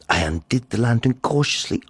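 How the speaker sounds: usual speaking voice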